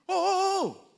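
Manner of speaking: high startled cries; startled exclamation. Pretend. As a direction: cry out in sudden alarm. Jesus startled in the crowd.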